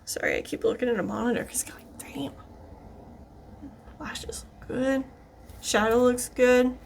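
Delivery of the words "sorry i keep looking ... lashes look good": breathy under-the-breath whisper. Real, not acted. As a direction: whisper to yourself while concentrating. Focused on mirror, muttering to self.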